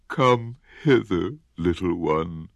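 drawn-out coaxing crocodile; slow crocodile voice. Acted. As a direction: lure him in slowly. Crocodile coaxes again.

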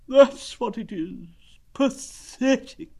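high, drawn-out lament; slow, high donkey voice of self-pity; gloomy donkey. Acted. Donkey looking at himself in the water, gloomy.

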